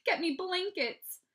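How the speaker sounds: high, loud, slowed plea; begging. A narrator's reading. Mom sends Peter for blankets, begging. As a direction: urge it out word by word. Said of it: "Mom begged".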